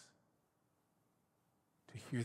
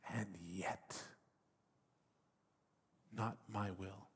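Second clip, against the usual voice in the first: broken prayer; 2 semitones lower, 6 dB quieter than usual. Retold or imitated. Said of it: "So that prayer, I imagine".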